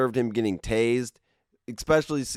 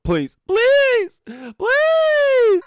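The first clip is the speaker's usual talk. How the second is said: very high, loud, stretched shrieking please; hammed-up high pleading shriek; mock desperate begging; 6 dB louder, much slower than usual. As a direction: wail the pleas like a desperate squeal.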